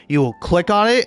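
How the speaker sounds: ordinary delivery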